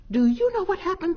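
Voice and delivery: high, fast and loud; excited. A narrator's reading. Mamma Cat telling her news, excited and fast. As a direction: burst out with news. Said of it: "Mamma Cat said with excitement".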